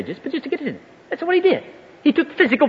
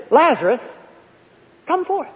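Lazarus called, then higher on the command; calling out; 6 semitones higher than usual. Retold or imitated. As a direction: summon someone from the tomb. Acting Jesus calling the dead man.

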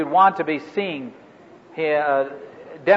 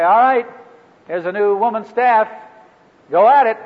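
loud, higher-pitched mock hand-off of a job; abrupt send-off; 4 semitones higher, 4 dB louder than usual. Leader handing a new staff over to someone, abrupt send-off. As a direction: toss out the order and be done with it. Offhand send-off he warns against.

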